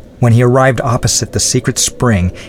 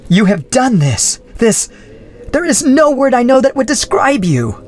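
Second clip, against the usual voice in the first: much higher, outraged voice; furious. Acted. Adrienne turning on the Baron, outraged and high. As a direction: hurl it at him in outrage.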